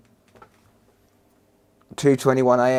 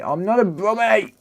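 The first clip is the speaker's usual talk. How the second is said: a high, jokey protest; playfully offended denial; high, teasing rebuttal of the brummie jibe; mock indignant; 9 semitones higher than usual. Real, not acted. Indignant reply to chat's brummie jibe.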